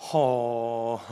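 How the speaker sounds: a short disbelieving huff; stunned exhale. Retold or imitated. Brother huffing when sister shuts off his game. Monitor switched off on him.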